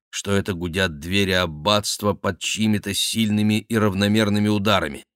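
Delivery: usual speaking voice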